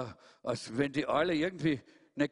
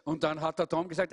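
plain reading voice

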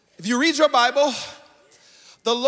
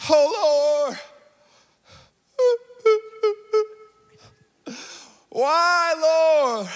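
a hint of Joshua crying out, lightly voiced; mock ugly crying; 6 semitones higher, much slower than usual. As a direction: lightly act out a tearful plea to the Lord. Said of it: "he is ugly crying".

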